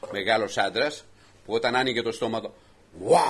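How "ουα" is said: giant's roar. Retold or imitated. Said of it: "φώναζε".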